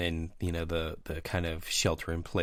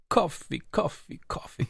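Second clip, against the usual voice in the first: high, strained mock gasp; overwhelmed defector; 10 semitones higher than usual. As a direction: squeak it out before fainting. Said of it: "He's like".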